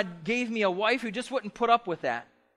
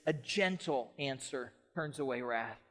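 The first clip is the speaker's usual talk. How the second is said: gentle; 4 semitones lower, 7 dB quieter than usual. Shown as a demonstration. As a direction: say it like a calm answer. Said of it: "a tone of gentleness".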